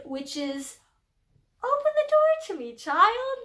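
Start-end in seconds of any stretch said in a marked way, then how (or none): 1.6-3.4 much higher and louder, a begging suitor at the door; pleading lover. Acted. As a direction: plead like a lovesick boy.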